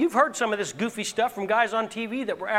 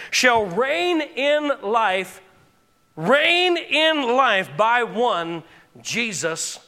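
slow, stretched preaching; slow and weighty; drawn out; much slower than usual. Acted. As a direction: lean on every word of it. Preacher stretching key phrase.

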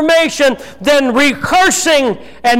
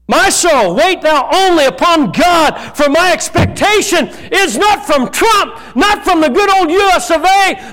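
fervent preaching shout; 5 semitones higher than usual. Real, not acted. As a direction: preach it hard at full force. Preacher climaxing against politics.

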